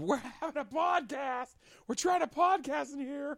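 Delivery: high-pitched yell, held back in volume; mock scream. Retelling yelling at background noise mid-podcast, mock scream. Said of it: "that I just screamed".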